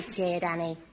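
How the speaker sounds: usual speaking voice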